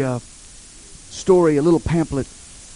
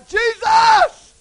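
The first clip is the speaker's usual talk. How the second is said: loud, high, drawn-out desperate cry; high shout to someone far ahead; 5 dB louder than usual. Retold or imitated. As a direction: call out at full voice for help. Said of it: "He, he cried".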